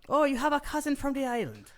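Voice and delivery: high, teasing fake Irish; mock Irish accent. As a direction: tease with a hammed-up Irish accent.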